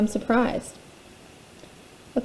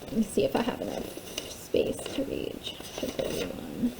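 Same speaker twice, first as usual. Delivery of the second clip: soft unvoiced whisper; 13 dB quieter than usual. Real, not acted. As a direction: whisper it under your breath. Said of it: "whispering asides to listener".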